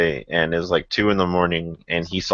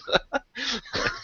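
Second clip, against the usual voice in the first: a high, breathless giggle after the punchline; high squealing laugh; 8 dB quieter than usual. Real, not acted. Laughing at the son's one-word reply.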